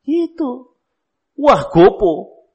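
mock scolding parent. Parent scolding a crying child.

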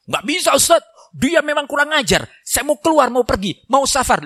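high, worked-up husband's complaint; protesting husband. Acted. Husband arguing with the ustaz about his wife, high-pitched mock protest.

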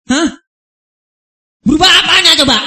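loud, exasperated challenge; indignant outburst. Real, not acted. Indignant rhetorical outburst at critics. (seruan kesal yang lebih keras)

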